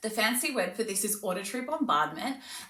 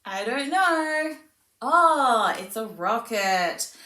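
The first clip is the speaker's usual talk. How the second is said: high, slow, sing-song wonder; slow teasing sing-song for a toddler; playful demo to child; 4 semitones higher, 8 dB louder, much slower than usual. Shown as a demonstration. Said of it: "you can cover it and go".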